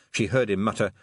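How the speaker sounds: ordinary delivery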